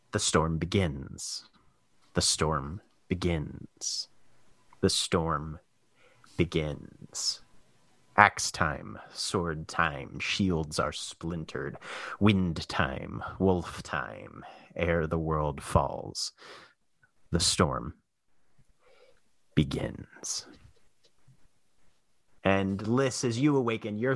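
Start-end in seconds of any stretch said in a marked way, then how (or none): 0.1-16.3 low, slow, ominous chant; [name]. Acted. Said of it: "[name]'s voice ripples through". 17.3-20.5 low chant fading to a whisper; [name]. Acted. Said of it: "[name]'s voice ripples through".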